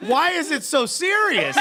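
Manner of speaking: high-pitched, drawn-out question through laughter; laughing. Real, not acted. Co-host reacting to a solemn soccer announcement, laughing hard. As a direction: crack up while asking.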